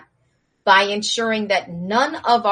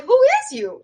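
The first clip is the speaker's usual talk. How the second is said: much higher, louder echo of the question; mocking echo; 6 dB louder than usual. The prince echoing Kuda's question, high mock echo. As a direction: parrot it back incredulously. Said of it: "And the Prince Serafi's like".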